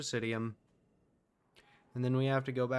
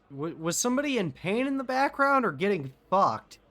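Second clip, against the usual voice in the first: shocked outburst; 8 semitones higher, 7 dB louder than usual. Real, not acted. Reacts to moaning from game.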